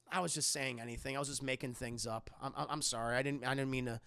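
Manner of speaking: soft, quick nervous sorry; timid apology. Retold or imitated. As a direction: mumble an embarrassed excuse. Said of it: "And he goes".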